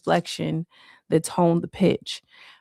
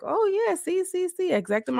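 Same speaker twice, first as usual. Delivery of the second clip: high-pitched agreement; 13 semitones higher than usual. Retold or imitated. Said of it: "He's like".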